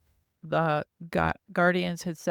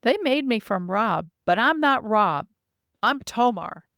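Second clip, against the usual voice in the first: put-on higher, louder little boy voice; childlike voice; 5 semitones higher, 5 dB louder than usual. Narrator voicing the infantile being introducing himself. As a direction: speak like an innocent small child. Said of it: "he said".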